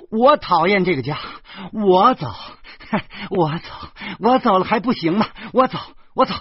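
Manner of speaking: high, loud, enraged shouting; furious outburst. A narrator's reading. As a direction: yell like a wife storming out. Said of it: "我老婆越发的暴怒起来".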